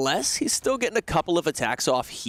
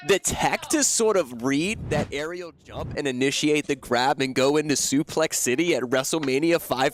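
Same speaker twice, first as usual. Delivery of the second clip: esports caster; 3 semitones higher than usual. Real, not acted. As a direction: gush over the play. Hyped play-by-play recap.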